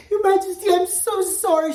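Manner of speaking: high, loud, flustered apology; a cook fretting, pleading to be forgiven. Acted. Cook flustered before the king, voice high and loud. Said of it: "she said in a flustered voice".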